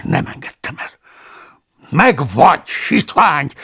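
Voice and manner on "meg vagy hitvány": loud, triumphant cry in his face; satisfied shout. Acted. Paulus shouting in the stranger's face, triumphant. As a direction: shout it out, pleased to have caught him. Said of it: "kiáltottam az arcába elégedetten".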